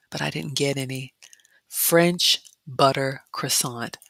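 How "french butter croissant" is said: "French butter croissant" drawn out very slowly; slow savoring. Real, not acted. Vlogger reading a pastry label she missed, slow savoring.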